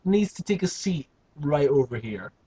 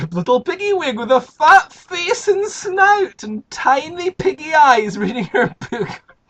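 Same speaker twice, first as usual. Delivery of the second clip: amused telling; 9 semitones higher, 6 dB louder than usual. A narrator's reading. Amused high narration.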